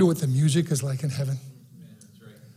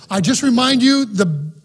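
higher, louder closing exhortation; fervent preaching; 4 semitones higher, 4 dB louder than usual. Acted. Pastor closing the morning message, loud fervent preaching. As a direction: lift them up as you close.

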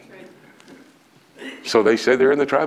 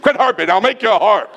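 teasing retort; 11 semitones higher, 6 dB louder than usual. Retold or imitated. Husband's retort, laughing.

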